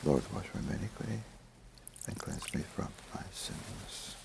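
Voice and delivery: breathy whispered prayer, almost silent. Real, not acted. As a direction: murmur it privately to God. Priest's silent prayers at the altar.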